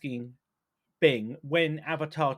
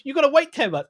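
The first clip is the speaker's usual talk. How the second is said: loud and higher, a hammed-up huffy machine; mock indignant chatbot; 9 semitones higher, 8 dB louder than usual. Host retelling Bing's rant to co-host, loud mock indignation. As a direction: play Bing losing patience with the user, half-mocking.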